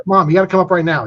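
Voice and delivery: put-on a kid begging mom to come right now; excited kid. Excited son calling mom.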